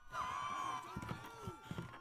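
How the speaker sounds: sharp high gasp; shocked gasp. Real, not acted. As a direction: gasp in surprise. Shocked at the screen.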